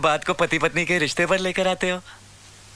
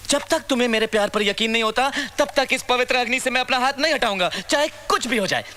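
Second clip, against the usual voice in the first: dramatic punchy hero lines; 6 semitones higher than usual. Acted. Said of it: "ये फिल्मी dialogues मुझ पे मत यूज़ करना।".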